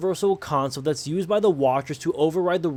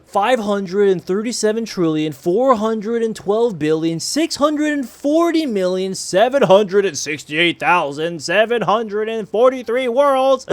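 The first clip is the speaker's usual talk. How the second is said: loud, breathless counting, higher; out of breath; 4 semitones higher, 5 dB louder than usual. Real, not acted. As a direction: rattle off numbers gasping for air. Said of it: "I'm out of breath".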